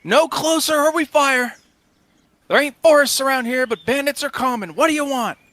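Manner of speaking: high, loud warning shout across a distance; shouted standoff warning; calling out. Acted. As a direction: challenge strangers from afar at full voice. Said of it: "kinda calls out to the group".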